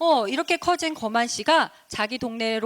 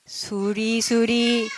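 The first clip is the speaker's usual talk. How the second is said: slow, drawn-out incantation; slow storybook magic chant; magic spell; much slower than usual. Acted. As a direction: stretch out each word like casting a spell. Fairy casts a magic spell.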